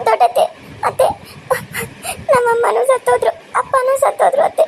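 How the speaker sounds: put-on tearful girl begging her aunt, voice catching; crying plea. Orphan girl begs aunt crying. (ಅಳುತ್ತಾ ಬೇಡಿಕೊಳ್ಳುವುದು)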